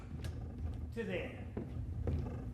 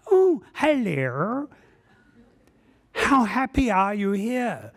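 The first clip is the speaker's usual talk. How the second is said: mock sweet greeting; 4 semitones higher than usual. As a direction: coax the artifact like a shy pet.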